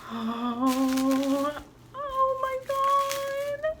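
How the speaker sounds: very high, drawn-out awe; overwhelmed, near tears. Real, not acted. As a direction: squeal high as if about to cry. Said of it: "I'm, like, going to cry".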